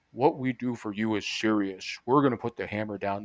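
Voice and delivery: hint of a grave TV attorney; mock serious lawyer. Mocking a serious attorney's TV ad.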